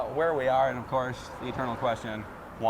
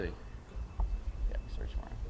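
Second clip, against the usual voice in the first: whispered aside; 8 semitones lower, 10 dB quieter, much slower than usual. Real, not acted. Quiet aside while searching.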